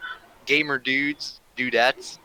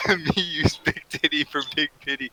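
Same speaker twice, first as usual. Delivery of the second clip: put-on goofy caveman baby talk; silly clown; 4 semitones higher than usual. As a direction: dumb silly voice, giggling. Absurd bit continues.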